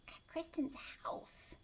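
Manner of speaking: slow whispered aside; secretive whisper. A narrator's reading. Narrator telling children a secret, whispered. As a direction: let them in on the secret.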